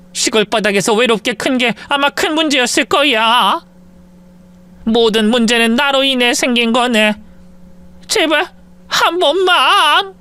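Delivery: weep out the blame, dragging the last plea; crying mother-in-law. Acted. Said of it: "장모님은 엉엉 울기 시작했어요".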